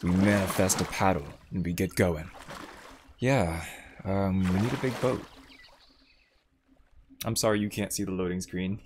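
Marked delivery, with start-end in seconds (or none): none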